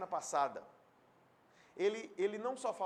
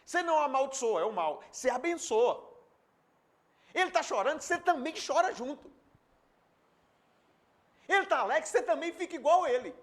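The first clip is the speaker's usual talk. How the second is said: higher, louder preaching; fervent preaching; 8 semitones higher, 9 dB louder than usual. Acted. Pastor urging the church to weep with others, loud. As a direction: build to a fervent call.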